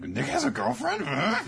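high incredulous squeal; shocked fan asking in disbelief; mock disbelief. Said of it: "people who are like".

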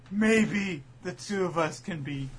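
very slow, drawn-out sappy pledge; hammed-up declaration of friendship; mock sentimental. Mocking retold friendship line.

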